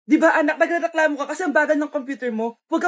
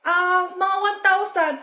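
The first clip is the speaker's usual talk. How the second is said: normal talking voice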